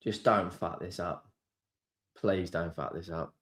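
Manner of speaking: low, near-silent begging; pleading under breath. Real, not acted. Nervous fan begging his team quietly, barely audible. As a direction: whisper a prayer to the team.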